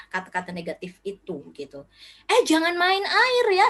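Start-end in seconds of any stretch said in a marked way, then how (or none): 2.3-3.7 high, louder, drawn-out warning; scolding parent. Retold or imitated. Parent telling a child off water play, mock scolding. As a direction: imitate a parent calling out a prohibition.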